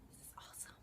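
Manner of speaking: a second fading whisper. Real, not acted. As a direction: breathe it out, trailing away. Whispered echo of own words.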